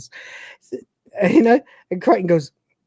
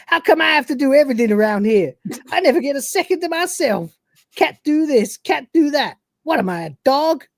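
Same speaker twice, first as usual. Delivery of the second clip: a lightly voiced whiny, higher diva Cat; prima donna; 6 semitones higher than usual. The Cat complaining about fetching a spare head, a hint of prima donna. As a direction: whine it like a diva. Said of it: "a little prima donna moment".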